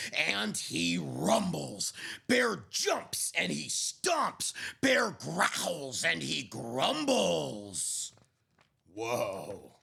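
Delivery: slow, hushed storybook reading; gentle picture-book narrator; story narration. A narrator's reading. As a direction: read it like a bedtime story. Picture book read aloud.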